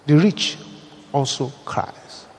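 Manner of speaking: whispered, slow and soft; hushed whisper. Real, not acted. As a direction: drop to a hush. Dramatic hushed punchline.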